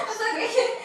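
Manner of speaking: loud, high laughing reassurance. Real, not acted. Teacher reassures a student who asks to repeat, laughing. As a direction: laugh out loud through the words.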